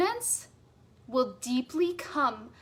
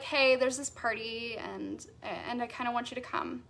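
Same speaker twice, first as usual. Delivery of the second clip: unexcited invitation; 2 semitones lower, 6 dB quieter than usual. Acted. Someone inviting you to a party, not excited about it. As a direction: invite them half-heartedly, dragging and fading. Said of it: "they're not excited about it".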